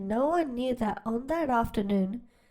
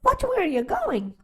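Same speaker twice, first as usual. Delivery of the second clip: flustered chicken; 8 semitones higher than usual. Acted. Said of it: "what she thought was".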